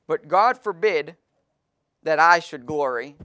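slow, loud, weighty reading of the verse; solemn scripture reading. Acted. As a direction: lean on each word of the verse, loud and slow. Preacher reads the verse aloud.

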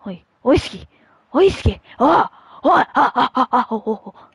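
taunting oh oh oh with laughter; taunting cheer. Real, not acted. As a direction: rub the goal in your friend's face. Celebrating a goal over friend.

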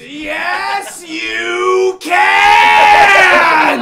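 high, drawn-out, building scream; hammed-up scream. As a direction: scream the contradiction at full blast. Acted contradiction in argument.

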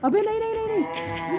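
high-pitched burst of protest; urgent, high repeated refusal; alarmed objection. Real, not acted. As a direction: cry out to stop it at once. Sudden objection during game.

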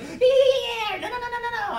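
squeaky machine-gun repetition of yeah and no; mock nervous repeat. Shown as a demonstration. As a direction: rattle the words off up high like a nervous tic. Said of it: "I repeat a word, like, over and over".

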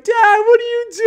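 put-on a nine-year-old sobbing out a plea to his father; imitating a crying kid; crying child. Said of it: "like, crying".

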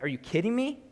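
high, rising disbelief; incredulous. Retold or imitated. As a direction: voice God's exasperation with a lifted pitch. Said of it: "He's like".

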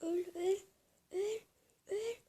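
excited high yells at the enemy; urgent game cry. Real, not acted. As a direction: punch out each cry, high and loud. Urging an enemy to die.

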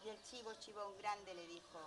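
ordinary delivery

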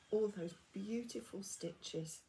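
ordinary delivery

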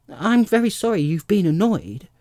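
put-on high, loud child's voice; Alice's lifted, placating voice; apologetic little girl. A narrator's reading.

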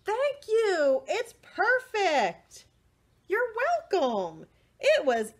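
high loud happy voice; high delighted characters; delighted thanks. Acted. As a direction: gush with joy up high. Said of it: "So he did".

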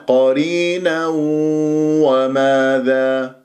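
slow chant, nasal w joining قرينا to وماذا; long nasalised link between قرينا and وماذا; merging with ghunna. Shown as a demonstration. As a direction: hum the tanween of قرينا into وماذا. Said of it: "مع واو وماذا إدغام".

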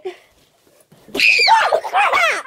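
shrieks then bursts out laughing; jumped by surprise, scream and laugh; startled scream-laugh. Real, not acted. Startled finding him in closet.

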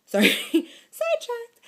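high, slow laughing apology. Real, not acted. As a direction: giggle through it, caught off guard. Distracted by TV, laughing.

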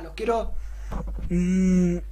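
usual speaking voice

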